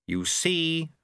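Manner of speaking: understated declaiming cry; mild shout. A narrator's reading. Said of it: "he shouted".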